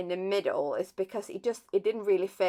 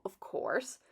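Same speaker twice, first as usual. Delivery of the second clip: ordinary talk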